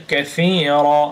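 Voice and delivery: কাসিরা with long "ee" and open final "a"; slow, stretched কাসিরা ending on a vowel; pausal recitation ending. Shown as a demonstration. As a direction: draw out কাসিরা, dropping the final n. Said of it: "থামলে".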